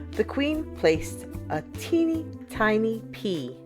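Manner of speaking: plain reading voice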